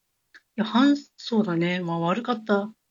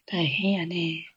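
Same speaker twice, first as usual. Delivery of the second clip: whispered; 4 semitones lower, 5 dB quieter, much slower than usual. Real, not acted. Murmured aside.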